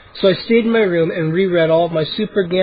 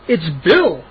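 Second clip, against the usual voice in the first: plain reading voice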